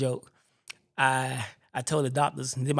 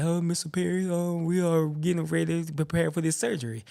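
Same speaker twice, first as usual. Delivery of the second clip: steady, lightly put-on surgeon voice; confident surgeon; 4 semitones higher than usual. Surgeon briefs his patient before the operation, with easy confidence. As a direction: imitate a self-assured doctor, pitched up a little. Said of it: "he's confidence in his speech".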